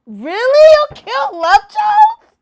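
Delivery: high, loud, stunned disbelief; can't believe what she just heard; shocked disbelief. Real, not acted. Hearing the friend would kill Love Jones, shocked disbelief.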